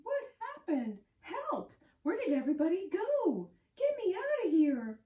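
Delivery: high, loud panicked calls for help; frantic high shouts from under the popcorn; panicked cries. Acted. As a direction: yell for help buried in popcorn. Friends trapped in popcorn.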